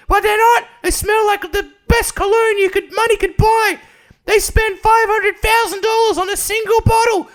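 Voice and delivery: put-on very high, shouted British old-woman falsetto; Whitney Cummings. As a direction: bellow it as a hammed-up sitcom granny. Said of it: "on stage just going".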